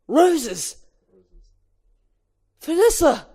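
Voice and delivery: high, loud eureka call; excited shout. Acted. Barry calls out to Vanessa, a loud high excited shout. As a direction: yell it as the idea hits.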